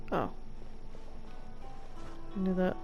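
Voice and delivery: ordinary talk, nothing marked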